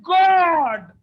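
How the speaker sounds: desperate shouted lament in prayer; anguished prayer. Acted. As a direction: cry out to God like a desperate believer. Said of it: "and saying that".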